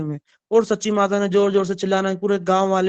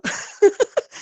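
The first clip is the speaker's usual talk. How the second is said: high laugh; 14 semitones higher than usual. Real, not acted. Laughs at child's refusal.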